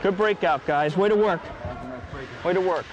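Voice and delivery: calling out encouragement. Real, not acted. Calling out to teammates on ice.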